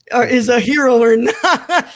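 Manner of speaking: laughing through the words. Real, not acted. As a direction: chuckle as you finish the line. Laughing at own joke.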